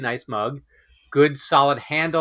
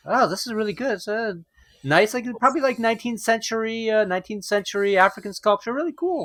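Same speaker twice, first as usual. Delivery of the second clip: high, excited appreciative friend; quoting a friend; 8 semitones higher than usual. Retold or imitated. Friend admiring the mug, gushing high. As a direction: gush about the sculpture like an art lover. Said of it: "he's like".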